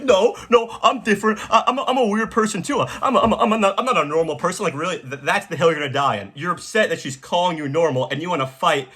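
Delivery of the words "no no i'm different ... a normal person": high mock whine with laughter breaking in; mock offended insistence. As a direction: insist you're weird, pitched up and laughing. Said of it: "they're like".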